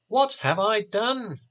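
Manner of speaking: high, shocked cry of alarm; shocked exclaim. A narrator's reading. Magician horrified at the blood, high alarmed cry. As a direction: gasp it out in horror, voice jumping up. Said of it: "Jack exclaimed".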